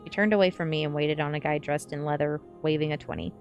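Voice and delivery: usual speaking voice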